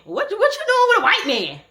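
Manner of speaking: very high, loud, shocked grandma; judgmental grandma. Retold or imitated. Grandma reacting to a date, shocked and high. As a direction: play the grandma scandalized.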